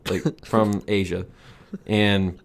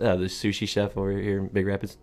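ordinary delivery

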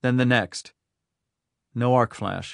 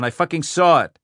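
louder, higher furious cry; 5 semitones higher, 7 dB louder than usual. Acted. Rhyme shouts at his aide, furious. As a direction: spit out the curse.

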